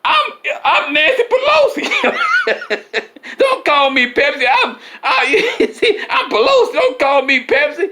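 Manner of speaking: Nancy objecting to being called Pepsi, high and giggling; indignant Nancy Pelosi. Acted. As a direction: squeal it offended through laughter. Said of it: "Nancy go".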